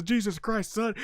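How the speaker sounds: imitating Stone Cold. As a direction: scoff at him. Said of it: "Stone Cold says".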